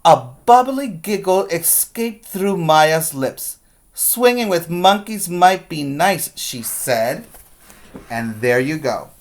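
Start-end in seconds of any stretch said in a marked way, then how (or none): 0.0-6.3 put-on lively storybook reading for kids; read-aloud story voice. A narrator's reading. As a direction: read it like a teacher to the class.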